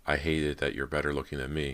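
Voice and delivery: plain reading voice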